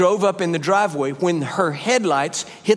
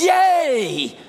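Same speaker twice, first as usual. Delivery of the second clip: long, high cheer of delight; gleeful cheer; 10 semitones higher than usual. Acted. Preacher showing how people ought to give, gleeful. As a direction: stretch out a high, joyful hooray. Demonstrates joyful giving.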